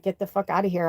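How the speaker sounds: normal talking voice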